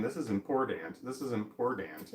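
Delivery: usual speaking voice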